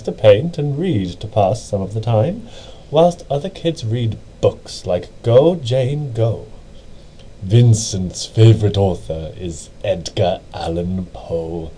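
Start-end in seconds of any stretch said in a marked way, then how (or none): none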